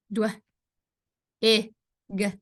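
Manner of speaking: Dw-, i, g as three isolated sounds; long gaps between the blend Dw-, short i and final g; sounded out. Shown as a demonstration. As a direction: phonics demo, one sound at a time in Dw-, i, g. Teacher sounds out a blend word.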